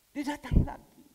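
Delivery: a loud preacher's punch; emphatic loud. Real, not acted. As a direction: land it hard for the congregation. Repeats arrival line, emphatic.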